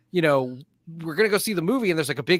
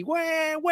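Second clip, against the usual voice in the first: high mock baby cry; imitated baby wail; 13 semitones higher than usual. Imitating the baby crying in the theater, high wail. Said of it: "And you just hear a baby".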